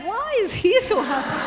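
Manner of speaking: high, suspicious wife's question; mock suspicion. Joking to the audience about wives' suspicion, high voice. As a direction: squint at him in mock suspicion.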